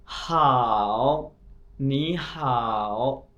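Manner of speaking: हाओ and नी हाओ stretched very slowly, tones exaggerated; tone demo. Shown as a demonstration. Teacher shows students the tones of नी हाओ, very slowly. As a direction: say हाओ, then नी हाओ, syllable by syllable very slowly. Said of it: "अब ये देख लें".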